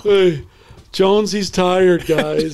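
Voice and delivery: slow, high, mocking call to the team; mocking announcement. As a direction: hold up the tired player for ridicule. Coach mocks player to team.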